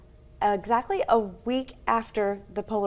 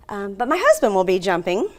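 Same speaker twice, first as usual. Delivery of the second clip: ordinary talk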